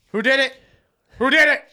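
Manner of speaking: loud and high, demanding who did it; angry dad yelling. Retold or imitated. Father yelling at the kids about the burnt floor. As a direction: bark it like a furious father.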